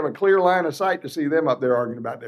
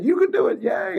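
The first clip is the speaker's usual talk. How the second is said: self cheer; 6 semitones higher than usual. Retold or imitated. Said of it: "And I said".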